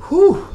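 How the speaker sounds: sharp high exhale of relief; loud high whew. Real, not acted. Exhales from pepper burn.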